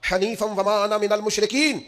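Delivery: slow Arabic chanting recitation; slow Quran recitation in Arabic; Quranic recitation. Acted. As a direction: intone the verse slowly and devoutly. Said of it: "پڑھنا شروع کیا".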